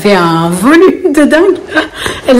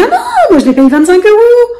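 much higher put-on voice for the midwife; imitating midwife; 11 semitones higher than usual. Midwife telling her what she pays, voiced much higher. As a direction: chirp the price back brightly. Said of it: "elle me dit".

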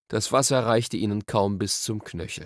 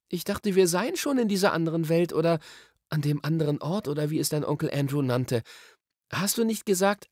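put-on higher girl-character voice, questioning; Polly, puzzled; 7 semitones higher than usual. A narrator's reading. Polly questions Diggory about his uncle's other world, puzzled. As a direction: query it in a raised voice.

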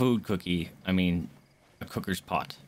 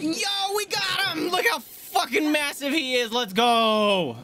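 excited yell; 14 semitones higher, slower than usual. Real, not acted. Player celebrating catching the huge horse, excited yell. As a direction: whoop it out in triumph. Capturing the giant horse in game.

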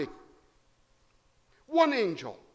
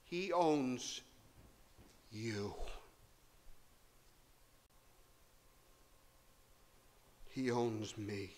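hushed; 8 semitones lower, 11 dB quieter, slower than usual. Real, not acted. Preacher's hushed climax on ownership.

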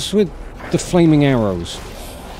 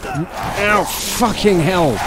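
high, drawn-out pained curse; pained cry; 7 semitones higher, much slower than usual. Real, not acted. Player hit by a flaming arrow, pained drawn-out cry. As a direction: stretch the swear out as it hurts.

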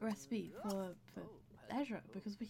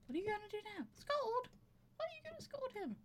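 much higher, squeaky disbelief; rising into high-pitched disbelief; shrill mock outrage at her sim; incredulous; 12 semitones higher, faster than usual. Real, not acted. Mocking the sim's reaction.

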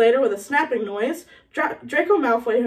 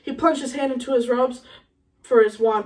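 usual speaking voice

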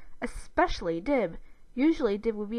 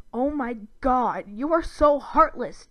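slow, loud, drawn-out scorn; sneering disgust; 4 semitones higher, 8 dB louder than usual. A narrator's reading. Brother condemning his heartless sister, sneering. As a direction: lean hard on the insult with contempt. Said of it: "Dib sneered".

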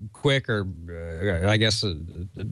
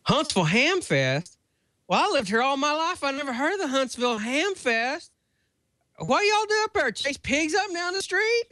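put-on Southern Birmingham accent. Birmingham waitress in her Southern accent, teasing a ham visitor. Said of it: "in this lovely Birmingham, uh, accent goes".

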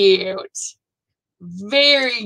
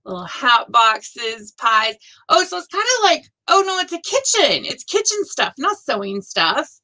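ordinary talk, nothing marked

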